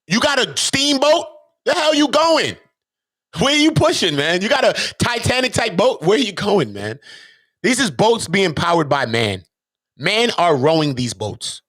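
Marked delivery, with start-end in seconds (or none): 0.1-7.0 high, incredulous teasing questions; mock sailor questions. Teasing an imagined sailor about his boat, incredulous. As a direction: needle him like he's crazy to sail out. Mocking the ancient sailors' boats.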